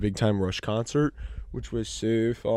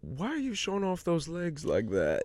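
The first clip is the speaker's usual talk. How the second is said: put-on scolding old lady; 6 semitones higher, 4 dB quieter than usual. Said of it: "And she goes".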